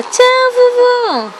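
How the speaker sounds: put-on high, sing-song baby talk; baby talk to grandma. Final goodbye to grandma for baby. (voz fininha de bebê falando com a vovó)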